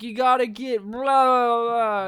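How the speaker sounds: words melting into blah noises; drugged slurring imitation. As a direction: imitate a man on muscle relaxant. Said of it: "muscle relax-, relaxing agents injected into his body".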